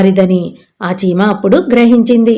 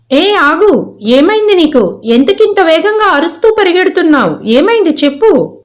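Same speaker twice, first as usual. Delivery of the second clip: higher-pitched fawn voice; questioning second fawn; 7 semitones higher than usual. Acted. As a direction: call after a running friend, pitched up. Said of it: "వేరొక జింకపిల్ల దానిని చూసింది". (ఆపి ప్రశ్నిస్తున్న జింక పిల్ల గొంతు)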